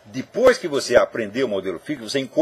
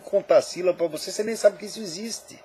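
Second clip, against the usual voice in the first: ordinary delivery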